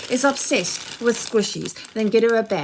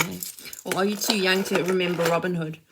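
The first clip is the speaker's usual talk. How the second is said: ordinary delivery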